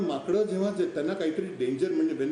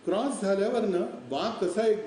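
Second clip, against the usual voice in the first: plain reading voice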